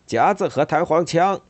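high, loud proclamation; loud declaration. Acted. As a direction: announce it like an alarm. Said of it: "他宣布".

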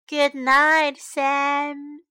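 mildly weary, sighed good night; a touch of sleepy resignation; sighing. A narrator's reading. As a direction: sigh it out to end the day. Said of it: "sighed Stella".

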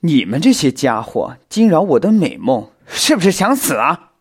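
loud threat climbing in pitch; angry roar. A narrator's reading. Spider woken from a sweet dream, angry roar. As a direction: bellow at the intruders, building. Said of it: "大蜘蛛貌似有些生气，冲着他们吼道".

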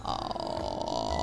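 low, creaky vocal fry. Shown as a demonstration. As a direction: croak it out in fry. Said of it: "那个气泡音我真的会".

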